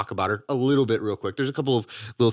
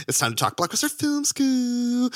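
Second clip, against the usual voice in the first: hyped catchphrase; 13 semitones higher than usual. Acted. Show catchphrase.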